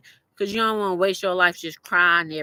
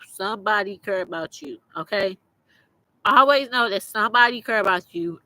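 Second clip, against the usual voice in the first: normal talking voice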